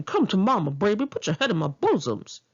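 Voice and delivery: a lightly put-on Big Mama; retelling a coworker's Big Mama bit. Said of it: "always did a Big Mama impression".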